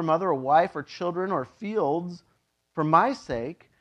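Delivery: ordinary talk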